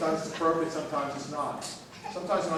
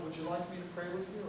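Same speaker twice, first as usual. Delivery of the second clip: softer, polite offer, a question not an order; gentle asking; 3 semitones higher, 6 dB quieter, faster than usual. Shown as a demonstration. Pastor showing the right way to offer prayer, softly asking. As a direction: ask permission softly. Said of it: "But, you know, you say".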